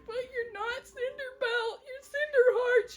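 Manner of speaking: high, mock-heartbroken plea; pleading lover. Lovelorn cat protesting to his beloved, high mock pleading. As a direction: pitch it way up, pleading in a hammed-up way.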